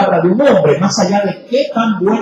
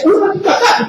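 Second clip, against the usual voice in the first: mock mother; 11 semitones higher, faster than usual. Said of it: "Y decía".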